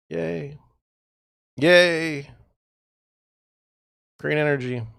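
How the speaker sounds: sarcastic cheer; sarcastic yay. Real, not acted. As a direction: fake celebration, dripping sarcasm. Mocking politicians' green energy.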